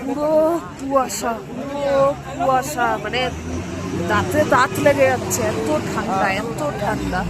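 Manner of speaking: calling out in the cold; loud. Real, not acted. Calling out on the windy pass.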